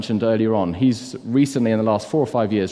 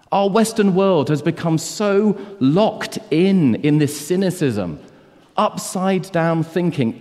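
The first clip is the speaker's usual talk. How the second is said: rally the church against cynicism; preaching; 4 semitones higher than usual. Acted. A preacher urging his church on Easter, fervent.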